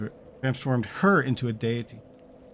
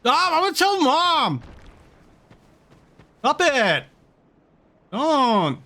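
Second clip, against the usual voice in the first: put-on high, loud whiny kid voice; whiny tattling little sibling; 11 semitones higher, 4 dB louder, slower than usual. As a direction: whine like a kid telling on a brother. Mock sibling tattling on Dennis.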